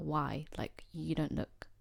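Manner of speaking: ordinary delivery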